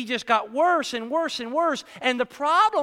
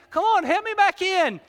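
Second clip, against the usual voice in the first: high, loud cheery plea; excited plea; 9 semitones higher, 4 dB louder than usual. Retold or imitated. Jonah in the sea calling the sailors, high eager plea. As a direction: call up to the boat, eager.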